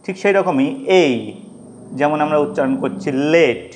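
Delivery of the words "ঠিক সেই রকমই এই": ordinary talk, nothing marked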